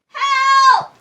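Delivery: high, loud desperate yell; terrified shout; panicked cry for help. Acted. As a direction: scream for help as the boat tosses. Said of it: "cried the sailors".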